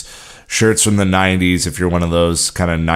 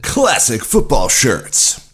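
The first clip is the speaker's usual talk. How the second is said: slow, booming announcer intro; 6 semitones higher, slower than usual. Pretend. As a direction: present it like a ring announcer. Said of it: "that was my announcer voice".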